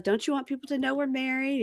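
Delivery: high, soft pleading voice; lightly voiced wife; whiny wife. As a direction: wheedle like a pouting wife. Wife voiced asking husband.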